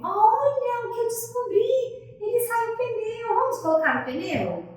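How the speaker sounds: high, loud, slowed "olha" of wonder; excited discovery. Shown as a demonstration. Therapist thrilled to show the child the toy's tyre.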